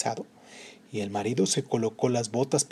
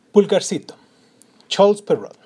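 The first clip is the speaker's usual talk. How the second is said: title announcement; 6 semitones higher, 6 dB louder than usual. A narrator's reading.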